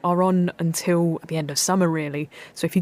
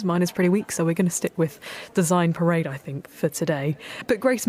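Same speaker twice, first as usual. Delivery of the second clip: ordinary delivery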